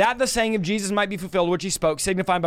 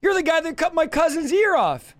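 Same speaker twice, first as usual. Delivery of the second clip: higher, pointed "dude" call-out; lightly voiced accusing cousin; casual accuser; 8 semitones higher than usual. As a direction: catch him out like a guy on the street.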